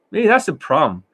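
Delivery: ordinary talk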